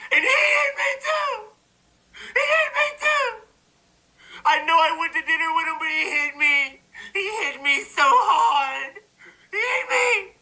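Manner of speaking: very high, wailing mock cry with laughs; hammed-up fake sobbing. Mocking accusers lining up with high fake sobbing. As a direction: wail it like a fake victim.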